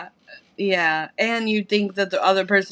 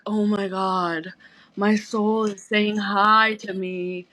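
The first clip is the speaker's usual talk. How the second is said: mock awe; much slower than usual. Mocking own twin-flame reading.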